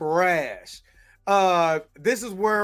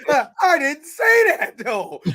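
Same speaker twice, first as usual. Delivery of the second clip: higher, louder, slowed-down fake innocence; high mock denial; 7 semitones higher, 4 dB louder, slower than usual. Mimicking the denial for friends, high and drawn out innocence. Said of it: "He was like".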